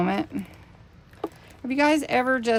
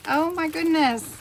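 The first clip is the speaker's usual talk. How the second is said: high overwhelmed gasp of delight; real astonishment at a gift; oh my goodness, pitched up in awe; surprised; 8 semitones higher, 4 dB louder than usual. Real, not acted.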